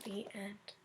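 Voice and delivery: soft, unvoiced whisper; whispered ending. A narrator's reading. Storyteller closes the book, whispered softly. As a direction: breathe the last words.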